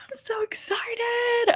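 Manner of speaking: hushed squeal of excitement; excited whisper. Real, not acted. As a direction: whisper it like a secret you can't contain. Thrilled about a book.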